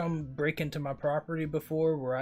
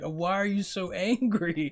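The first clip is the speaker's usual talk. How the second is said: higher, slow, drawn-out mock yell; mock self-yelling; 5 semitones higher, 4 dB louder, slower than usual. Retelling yelling at oneself while driving, exasperated mock yell. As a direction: imitate scolding yourself, stretching the words. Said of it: "yelling at myself".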